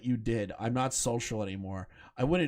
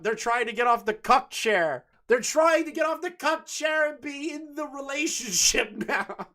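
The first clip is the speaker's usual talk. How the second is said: excited; 14 semitones higher, 4 dB louder than usual. Real, not acted. Excited mocking commentary.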